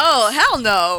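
slow, drawn-out "hell no"; emphatic refusal. Real, not acted. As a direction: stretch out the refusal. Refusing to be a Bears fan.

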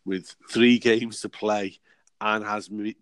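ordinary talk, nothing marked

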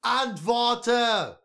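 drawn-out, very high, loud yell; furious scream. A narrator's reading. Father screaming at his silent child, furious demand. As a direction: scream the command at full pitch.

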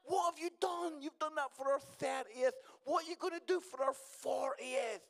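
high, loud, incredulous friends; mock friends shouting. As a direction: shout it like shocked mates. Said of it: "all my friends were like".

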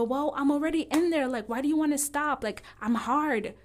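a loud, whiny imitation of a man demanding to finish; mocking a pushy man. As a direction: ham up the pushy boyfriend who won't stop. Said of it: "and they go".

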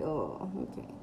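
low, quiet knowing "oh okay"; a hint of a dad catching on. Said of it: "and he's like".

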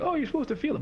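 high, airy parody of advice; mocking glib advice. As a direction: mimic someone giving pat advice. Mimicking glib diet advice.

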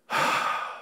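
soft, airy sigh; heavy sigh. Acted. Preacher acting a partner's sigh for connection. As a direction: let out a weary sigh. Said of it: "I heard the big sigh".

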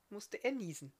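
plain reading voice

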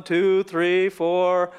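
ordinary talk, nothing marked

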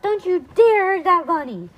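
high, loud, outraged warning; Dottie's shout. A narrator's reading. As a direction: shout in defence of the bunny. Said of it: "shouted Dottie".

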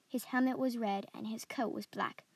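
plain reading voice

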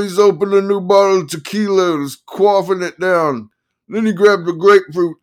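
high, loud yelling reads on. Acted. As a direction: keep the attorney's yell going by mistake. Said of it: "he yelled".

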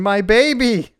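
put-on high, cooing little girl voice; toddler voice. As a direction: coo it like a small child. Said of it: "just going".

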